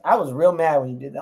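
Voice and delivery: ordinary talk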